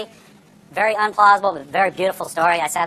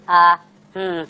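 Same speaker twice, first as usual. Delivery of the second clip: filler hum; 4 dB louder than usual. Shown as a demonstration. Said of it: "you hear".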